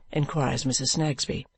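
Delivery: usual speaking voice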